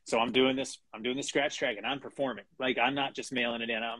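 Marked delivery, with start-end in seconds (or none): none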